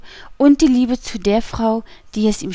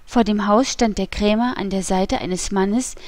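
plain reading voice

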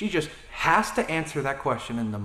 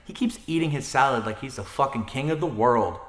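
ordinary talk, nothing marked

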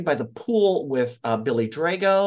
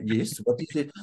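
put-on French accent; 4 dB quieter than usual. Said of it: "he's so French".

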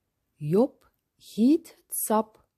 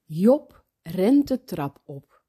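plain reading voice

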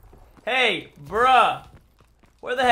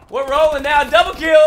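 higher and louder, hyped; excited cheer; 4 semitones higher, 8 dB louder than usual. Real, not acted. Gamer celebrating a double kill, hyped loud cheer.

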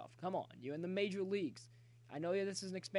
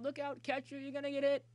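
raised, urgent call getting louder; alarmed warning; 8 semitones higher, 5 dB louder than usual. Real, not acted. Gamer warning the catcher he'll get hit, alarmed high call. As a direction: call out a sudden danger, pitched high.

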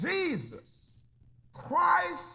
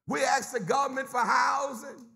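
preaching; 4 semitones higher than usual. Acted. Preacher rousing his church, full-force preaching. Preacher building congregation call.

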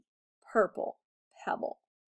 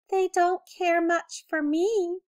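a small sad mouse voice, higher; 7 semitones higher, 4 dB louder than usual. A narrator's reading. Lonely mouse Alexander telling Willie, a touch sad. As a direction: mouse sighs that nobody cares for him, higher. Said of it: "said Alexander sadly".

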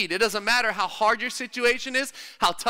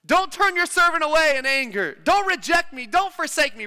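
higher, pleading cry to God; David crying out; 6 semitones higher, 3 dB louder than usual. Retold or imitated. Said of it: "begins to cry out to God".

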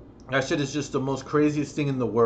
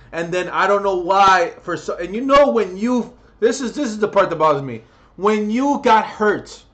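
loud, furious shouting at abusive parents; angry rant; 4 semitones higher, 7 dB louder than usual. Real, not acted. Ranting at abusive parents on camera, loud furious shouting. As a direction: yell it at them, really furious. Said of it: "I'm getting too annoyed".

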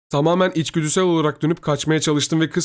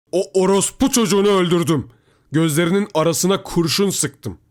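raised, loud voice carrying from afar; father shouting; 6 semitones higher, 5 dB louder than usual. A narrator's reading. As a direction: shout the news across a distance. Father shouts from far end. (uzaktan yüksek sesle bağırarak)

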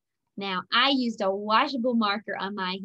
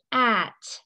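ordinary talk, nothing marked

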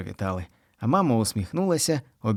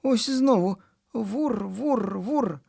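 boy mimicking snores; 11 semitones higher, much slower than usual. A narrator's reading. Boy imitates the snoring.

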